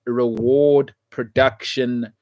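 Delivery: slow low mantra; slow emphatic. Real, not acted. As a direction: drag each word out like a motto. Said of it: "I'll say it again".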